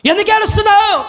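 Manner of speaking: high, sustained ringing question; Jesus calling out. Acted. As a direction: intone the question high, acting the divine questioner. Acts Jesus asking why weeping. (ఎలుగెత్తి సాగదీసిన ప్రశ్న)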